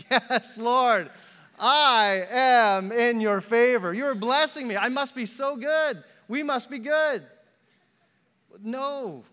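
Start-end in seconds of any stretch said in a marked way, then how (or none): 0.1-7.2 loud, high, hammed-up triumph; mock exultation. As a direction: crow it like a self-satisfied believer. Mock self-satisfied thanks for parking spot.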